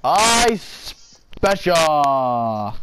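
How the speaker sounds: drawn out. Real, not acted. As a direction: drag every sound out. Drawn-out call in game.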